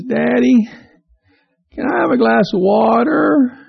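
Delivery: put-on a child asking sweetly from bed; little boy calling to his dad from bed. Little boy voiced in story.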